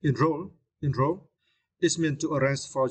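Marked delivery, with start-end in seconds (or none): 0.0-1.3 Enroll with stress on roll, en light; Enroll, second syllable ROLL stressed. Shown as a demonstration. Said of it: "you need to stress the second syllable".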